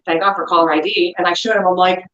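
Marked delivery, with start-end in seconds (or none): none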